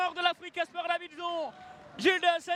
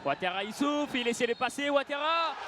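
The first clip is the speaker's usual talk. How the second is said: ordinary talk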